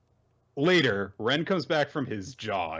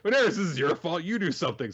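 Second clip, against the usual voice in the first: higher, hurried mock complaint; exasperated blame; 7 semitones higher, faster than usual. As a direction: demand help in a fluster. Ren blames Daenerys, demands action.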